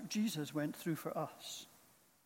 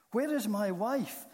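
high, loud anguished call; scream; 5 semitones higher, 7 dB louder than usual. A narrator's reading. Terrified man in the darkness calls for his wife, screamed. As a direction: raise voice and pitch in a narrated scream. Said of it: "he screamed".

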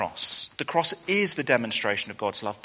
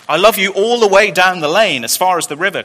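louder and higher, eager cry; child hare crying out his love, louder; cried out; 6 semitones higher, 12 dB louder than usual. Acted. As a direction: call it out like an excited little hare. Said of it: "cried little nut brown hare".

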